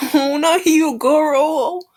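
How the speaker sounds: high, slow, drawn-out cry of protest; real grief over the mentor character; anguished wail. Real, not acted. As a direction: plead that it can't be him. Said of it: "I mean, we do need a death".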